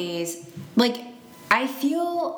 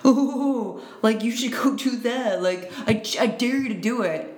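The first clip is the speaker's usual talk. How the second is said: mock dare voice; 3 semitones higher than usual. Mocking peer pressure dare voice.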